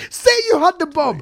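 loud, high joking interrogator; mock interrogator. Joking with the host, mock interrogator demanding a confession. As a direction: bark it like a cop forcing a confession.

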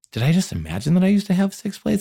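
high, bewildered self-questioning; high, disbelieving voice doubting own memory. Retold or imitated. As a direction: ask it as if doubting your own mind. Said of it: "And I was like".

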